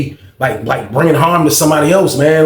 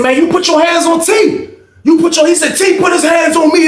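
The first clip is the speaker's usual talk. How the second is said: heated argument; 13 semitones higher than usual. Retold or imitated. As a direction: argue hard through the cell door. Heated argument with Jerome at door.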